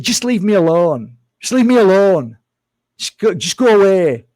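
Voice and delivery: imitating a caught man telling him to go; retold angry snap. Influencer caught eating duck tells him to leave, angry and loud. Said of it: "He turned so aggressive".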